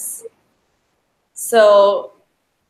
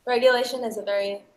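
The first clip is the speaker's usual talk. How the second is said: normal talking voice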